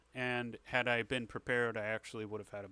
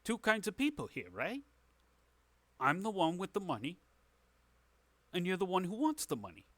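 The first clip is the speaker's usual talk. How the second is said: higher, quick in-character pitch; fast-talking dealmaker, pitched up; hard-bargaining tavern boss; 7 semitones higher, faster than usual. Acted. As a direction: lay down the terms as the moneyed boss. Game master plays boss bargaining.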